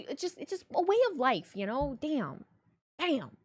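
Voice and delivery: venting hard about everyone being in debt; heated rant. Real, not acted. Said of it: "Who pissed off Wheezy?".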